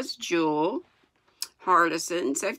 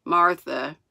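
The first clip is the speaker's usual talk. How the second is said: usual speaking voice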